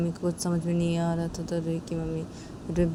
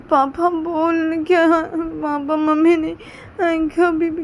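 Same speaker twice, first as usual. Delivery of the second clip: put-on high, slow child's voice, anxious; little girl pleading; 11 semitones higher, 9 dB louder, slower than usual. Little girl on the phone, anxious and pleading. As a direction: drag out the words like a worried small child.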